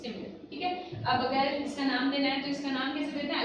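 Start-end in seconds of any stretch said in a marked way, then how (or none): none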